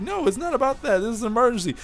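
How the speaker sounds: high-pitched pleading woman's voice; urgent woman. Acted. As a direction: raise the pitch to insist it's urgent. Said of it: "She's like".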